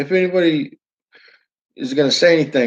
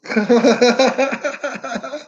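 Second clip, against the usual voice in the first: sudden high-pitched laugh; a real laugh at the joke; 10 semitones higher than usual. Real, not acted.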